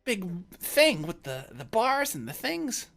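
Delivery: high, loud squeals of awe; overexcited character marvelling at the ship; giddy. Acted. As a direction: gush breakneck wonder at everything in sight. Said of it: "are both all giddy and running around".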